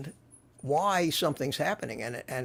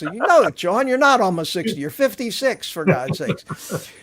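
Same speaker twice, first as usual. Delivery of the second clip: louder, higher mock scolding of John; exasperated scolding; 5 semitones higher, 8 dB louder than usual. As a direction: tell him off, half laughing in disbelief.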